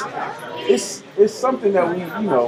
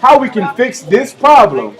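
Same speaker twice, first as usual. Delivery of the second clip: loud, emphatic projecting delivery; projected show voice; 5 semitones higher, 4 dB louder than usual. Real, not acted. Host introducing the story after sound trouble, projected show voice. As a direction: project it strongly to the listeners. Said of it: "talk like this the rest of the show".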